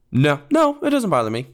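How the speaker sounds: high-pitched denial; voice going up. Real, not acted. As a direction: deny it with your voice jumping up. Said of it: "My voice went up".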